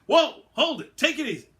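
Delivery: urgent shout breaking up a fight; shouting to stop. Acted. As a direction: yell to stop them fighting. Crichton stopping a fight.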